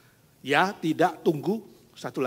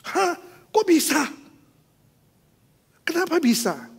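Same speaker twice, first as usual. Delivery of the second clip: high, loud astonished questions; can't believe what you just heard; stunned disbelief; 11 dB louder than usual. Acted. Wife hearing her husband now believes, high and astonished.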